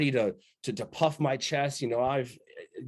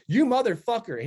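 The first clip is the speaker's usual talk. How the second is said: startled yell; 10 semitones higher, 5 dB louder than usual. Retold or imitated.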